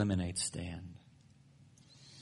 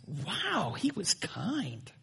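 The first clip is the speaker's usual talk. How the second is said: impressed wife; 7 semitones higher, 6 dB quieter, much slower than usual. Retold or imitated. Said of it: "Suzanne says".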